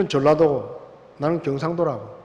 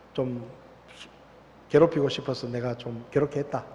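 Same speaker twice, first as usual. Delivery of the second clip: lower, softer, sheepish confession; sincere apology; 5 semitones lower, 6 dB quieter than usual. Retold or imitated. The senior confesses to the recruit he bullied, lower, softer apology. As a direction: admit the wrong quietly, a bit embarrassed.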